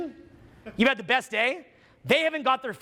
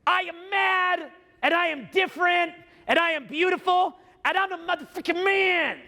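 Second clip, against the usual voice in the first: defiant shout; 8 semitones higher than usual. Acted. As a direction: yell it like a stubborn rebel. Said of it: "But you're like".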